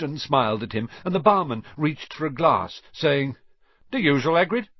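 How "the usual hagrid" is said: higher, louder barman's question; barman's greeting. A narrator's reading. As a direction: call out to a regular at the bar. Barman greets a regular customer.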